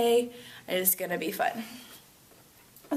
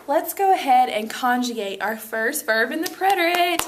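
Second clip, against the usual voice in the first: louder, building cheer to the end; playfully excited; 2 semitones higher, 5 dB louder than usual. Pretend. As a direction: hype it up like a big event. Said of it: "So excited".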